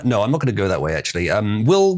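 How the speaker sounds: ordinary delivery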